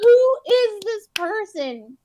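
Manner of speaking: high, slowed disbelief; baffled. Retold or imitated. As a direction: ask it stunned. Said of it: "I was like".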